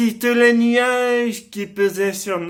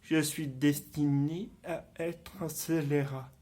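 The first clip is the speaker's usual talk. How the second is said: low, soft stage soliloquy; hushed and low, in measured phrases with long pauses; brooding confession; 4 semitones lower, 6 dB quieter than usual. Acted. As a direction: confide it darkly, as if thinking aloud. Acted villain confides his resolve.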